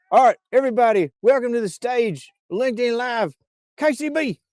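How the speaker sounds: high, rapid hype-man introduction; high-pitched welcome to the stage, drawn up at the name; stage announcer. Pretend. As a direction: bring her on like an excited show announcer. Introducing her with stage coming-in music.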